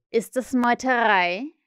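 slow, emphatic sweet question; menacingly friendly. A narrator's reading. Captain asks crew about mutiny, ominously friendly. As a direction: stretch it out with false kindness. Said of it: "fragte Huck freundlicher als je".

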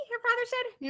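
plain reading voice

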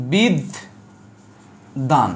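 বিদ, long pause, then a falling দান; split pronunciation. Shown as a demonstration. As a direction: break it into বিদ and দান, doubling the d. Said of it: "এই দেখো". (বিদ... দান, থেমে ভেঙে উচ্চারণ)

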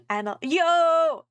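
big friendly yo; excited call. Acted. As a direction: call her over with excitement. Calling out to friend in chat.

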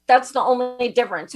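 normal talking voice

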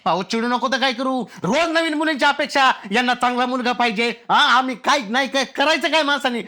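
high-pitched indignant tirade; worked up and fed up; exasperated rant. Acted. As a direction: protest heatedly, voice pushed up. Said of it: "एवढे चिडू नका".

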